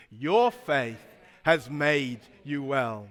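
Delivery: low, slow, measured phrase; solemn recitation. Shown as a demonstration. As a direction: lead them softly, word by word. Leads congregation in repeating the verse.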